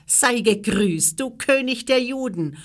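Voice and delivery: high, loud mock salute; jeering call. A narrator's reading. Soldiers kneeling in sport before Jesus, jeering. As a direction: hail him grandly in mockery. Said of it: "und riefen".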